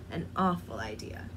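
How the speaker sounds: ordinary delivery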